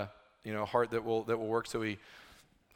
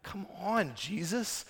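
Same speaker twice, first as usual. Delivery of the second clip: mock plea; 7 semitones higher, 4 dB quieter, slower than usual. Preacher pleads with Jesus.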